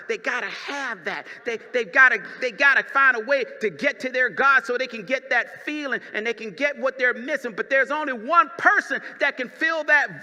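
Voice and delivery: building sermon. Acted. Preaching builds to climax.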